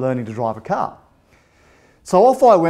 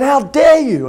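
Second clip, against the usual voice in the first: high, loud mock fury; hammed-up angry accusation; indignant outburst; 11 semitones higher, 5 dB louder, slower than usual. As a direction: rip into them with outraged indignation. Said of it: "I ripped into my parents".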